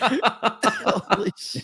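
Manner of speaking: laughing. Real, not acted. Stunned reaction, laughing.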